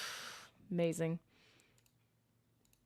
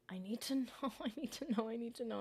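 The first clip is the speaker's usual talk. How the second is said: soft, higher pleading repeats; desperate; 4 semitones higher, 7 dB quieter than usual. Real, not acted. Streamer pleading softly to know about the remake. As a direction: beg to know, quietly. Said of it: "I'm gonna cry".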